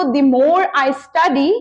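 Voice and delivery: very slow, each word set apart for learners; slow model sentence. Shown as a demonstration. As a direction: spell it out slowly like a teacher giving the example. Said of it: "तो मैं कैसे कहूंगी इसको". (धीमे-धीमे, साफ़ बोलकर उदाहरण वाक्य)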